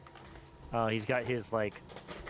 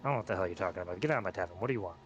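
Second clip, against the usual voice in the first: gruff goblin; 2 semitones lower, faster than usual. Acted. Goblin Happy denies, orders out.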